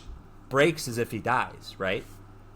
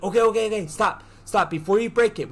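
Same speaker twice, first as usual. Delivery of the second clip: quick, higher-pitched alarmed protest; retold panicked interruption; 6 semitones higher, 3 dB louder, faster than usual. Bear stopping his friend from breaking it, panicky. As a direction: cut him off before he can do it. Character frantically halts friend.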